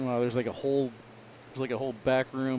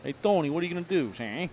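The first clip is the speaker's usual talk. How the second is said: lightly voiced 1930s gangster commentary; play-by-play like a mild old-time mobster, ending lines with see; a hint of a Mafia wiseguy calling the game. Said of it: "do your play-by-play for this round".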